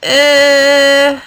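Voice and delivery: high, loud nasal-free grunt of indecision; undecided elephant grunt. Acted. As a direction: shrug it out as an elephant. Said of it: "He only answered".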